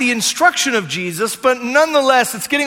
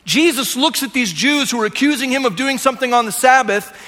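ordinary talk

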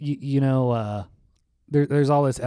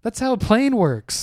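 deadpan teasing; 9 semitones higher, 4 dB louder than usual. Retold or imitated. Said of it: "And I go".

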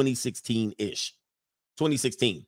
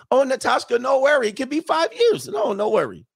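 high, loud mimicked foreign girlfriend accent; Natasha's accent; 11 semitones higher, 4 dB louder than usual. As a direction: backpedal fast in her high mock accent. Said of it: "in her accent".

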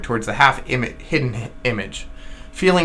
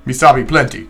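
grunted reply. Acted. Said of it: "grunted Queequeg".

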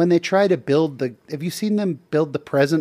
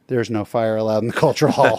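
mild stern bishop; 7 semitones lower than usual. Retold or imitated. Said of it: "the bishop took me aside and said".

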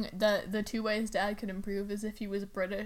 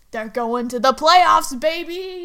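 excited cheer; 5 semitones higher, 13 dB louder than usual. Real, not acted. Fan cheering her quarterback's Eagles into the playoffs, loud and excited. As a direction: shout it like your team just made it.

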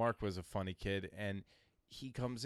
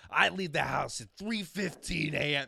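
loud, raised-pitch mimicry of dad; imitated father boasting; 5 semitones higher, 6 dB louder than usual. Father announcing his early start, imitated loudly and higher.

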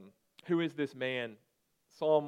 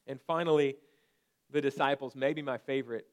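ordinary talk

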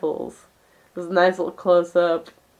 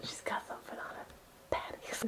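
whisper; 20 dB quieter than usual. Acted. Whispering behind her friend.

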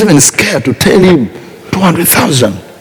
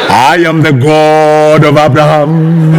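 booming God voice. Shown as a demonstration. Preacher parodying a dramatic God speaking to Abraham, booming. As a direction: announce it like a thundering deity. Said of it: "God is not going to speak like this".

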